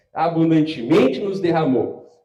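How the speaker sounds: slow, loud, proclaiming reading of the verse; scripture reading. Acted. Pastor reading Titus to the church, slow loud proclamation. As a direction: savor each word as if announcing it.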